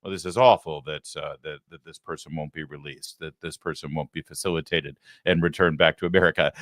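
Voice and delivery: mocking the news anchors' hand-wringing. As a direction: fret like a sanctimonious anchor. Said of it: "they're all wringing their hands saying".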